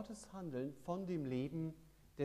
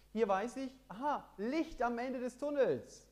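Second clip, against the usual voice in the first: erleichtert aufrufen; 10 semitones higher, 7 dB louder than usual. Acted. Predigt, erleichterter Ausruf.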